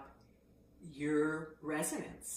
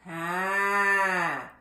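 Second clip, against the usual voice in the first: loud "hah" with an H onset; forward ah; 10 dB louder than usual. Shown as a demonstration. Voice teacher showing forward placement, loud hah. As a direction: push it out to the front. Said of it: "Find that H".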